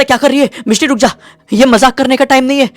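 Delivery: high, loud urgent calls to stop; alarmed shouting father. Acted. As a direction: shout her back from the edge. (घबराकर ऊँची आवाज़ में चिल्लाता पिता)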